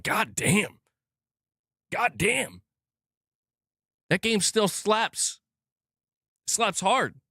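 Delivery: high-pitched, drawn-out awe; impressed reaction. Retold or imitated. Streamer reliving his reaction to gameplay he loves, impressed. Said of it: "And I'm like".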